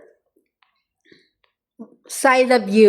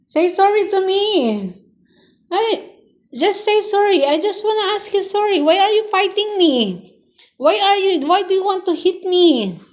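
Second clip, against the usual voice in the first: much higher, coaxing pet voice; 7 semitones higher than usual. Real, not acted. Owner begging her pet Leo to apologize, in high baby talk. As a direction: coax the pet in a high sing-song.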